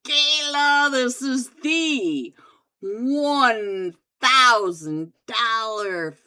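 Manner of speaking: loud hyped event announcer. Acted. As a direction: boom out the lineup like a host. Announces finals teams loudly.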